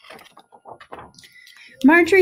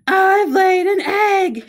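high, slow, loud shriek of joy; shrieking with amazement; 14 semitones higher, 7 dB louder, much slower than usual. A narrator's reading. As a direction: shriek it out like a thrilled cow. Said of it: "shrieked Marjorie".

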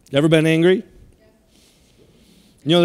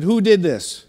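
stern parent; 4 semitones higher than usual. Retold or imitated. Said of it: "I'll ask them right before I spank".

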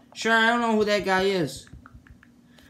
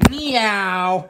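a single drawn-out meow like a cat. Pretend. Asked to meow like a cat, a cat meow. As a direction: meow like a house cat on request. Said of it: "Can you meow like a cat?".

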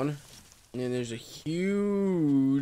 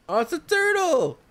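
much higher, louder delighted squeal; excited discovery; 4 dB louder than usual. Real, not acted. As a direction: burst out with glee at the find. Spots a turtle, delighted.

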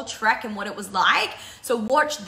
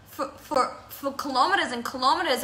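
ordinary talk

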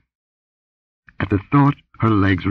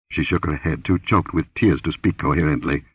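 plain reading voice

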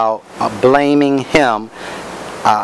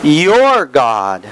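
YOUR raised and stretched before "God"; stress on YOUR. Shown as a demonstration. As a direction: punch YOUR to set it apart. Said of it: "I put emphasis on different words".

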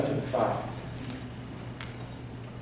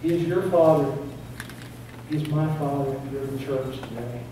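ordinary delivery

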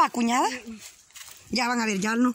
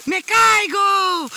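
drawn-out high yell, much louder; mock scream; 7 semitones higher, 8 dB louder, much slower than usual. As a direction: belt it out like you're falling. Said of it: "Grita fuerte". (grito fingido, muy alto y alargado)

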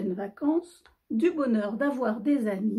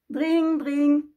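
high, louder "dring dring"; imitated phone ring; 4 semitones higher, 5 dB louder than usual. Phone won't let Noël be, insistent imitated ringing. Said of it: "fit soudain le téléphone".

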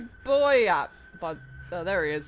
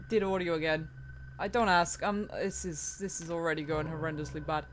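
ordinary delivery

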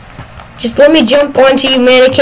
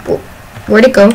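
ordinary delivery

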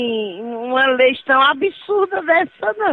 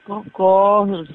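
ordinary talk, nothing marked

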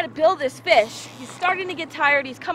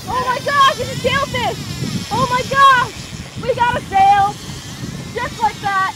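excited; 7 semitones higher, 5 dB louder than usual. Real, not acted. Hooking a sailfish, excited.

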